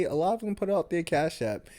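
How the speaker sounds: ordinary delivery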